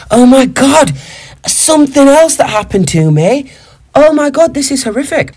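high, breathless burst of gossip; sudden excitement. Real, not acted. To his co-host recalling the flight, high and excited. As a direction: launch into the juicy story. Remembering a shocking story.

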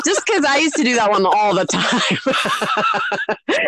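slow, laughing words; talking through laughter. Real, not acted. Confessing to doing the same gag, laughing hard. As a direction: crack up while saying it. Laughing after teasing the bong joke.

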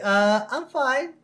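high, slow, put-on cartoon voice; acted hesitant reply. Cartoon character saying he's fine, slow high-pitched imitation. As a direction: draw it out high and unsure. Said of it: "He's like".

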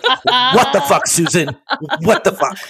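high, startled swearing with a laugh; shocked friend laughing in disbelief; shocked outburst. Acted. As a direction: Drew catching her friend sneaking in. GM voices Drew's shocked outburst.

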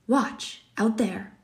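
understated excited pointing-out; exclaimed command. A narrator's reading. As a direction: call attention with a mild exclamation. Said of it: "exclaimed the general".

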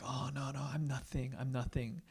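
soft mock humble murmur; false modesty. Churchgoer fishing for praise, mock humble and soft. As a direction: act falsely humble, softly. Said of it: "We're like".

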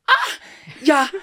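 hammed-up dawning surprise; mock sudden realization. Narrator acting her surprise as she hands over the keys.

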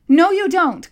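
high, loud contradiction; angry retort. A narrator's reading. Furious boy contradicting the monster, angry retort.

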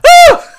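high, loud shriek of fright; scream. Retold or imitated. Jumping back from a huge spider, loud scream. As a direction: let out a sudden terrified shriek. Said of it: "and I was like".

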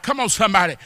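normal talking voice

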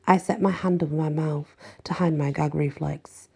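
ordinary delivery

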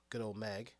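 ordinary talk, nothing marked

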